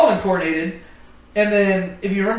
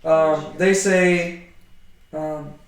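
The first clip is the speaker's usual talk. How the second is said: normal talking voice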